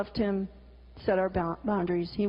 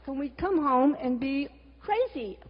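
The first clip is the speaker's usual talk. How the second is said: usual speaking voice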